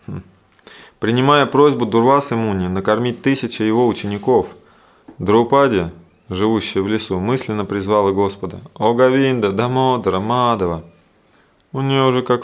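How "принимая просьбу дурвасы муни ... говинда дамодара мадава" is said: raised, louder verse reading; scripture reading. A narrator's reading. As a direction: read the verse out to the listeners, pitch lifted. Reads the verse aloud. (торжественное чтение стиха вслух)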